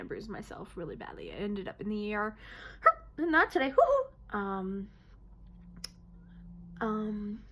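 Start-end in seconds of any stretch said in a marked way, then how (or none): 3.2-3.7 sudden, high-pitched tic; vocal tic. Real, not acted. Vocal tic in Tourette's talk. 3.7-4.4 sudden, very high, loud "woo-hoo"; vocal tic whoop. Real, not acted. Vocal tic in Tourette's talk.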